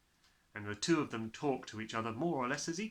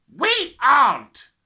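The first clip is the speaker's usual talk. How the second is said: loud, slow, high snapping dad; angry snap; 13 semitones higher, 19 dB louder, much slower than usual. A narrator's reading. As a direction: snap at the child. Father flatly shouts.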